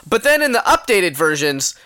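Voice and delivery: normal talking voice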